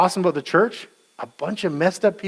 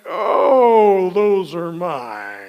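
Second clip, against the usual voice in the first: slow, stunned admission; sinking realization; 4 dB louder, much slower than usual. Retold or imitated. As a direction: let the heart drop mid-sentence. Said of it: "it's like".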